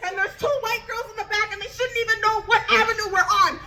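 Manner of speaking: high, rushed, panicky rant; upset panic. Retold or imitated. Telling friends about the drugs she just saw, high panicked rant. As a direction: freak out to your friends in a high voice. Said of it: "I'm all upset".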